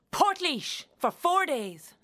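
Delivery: high, slow, drawn-out exclamation; incredulous reveal. Acted. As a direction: stretch out the absurd punchline in disbelief. Reveals the laughable honeymoon destination.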